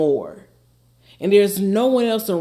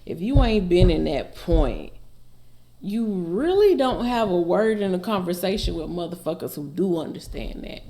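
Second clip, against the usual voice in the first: ordinary talk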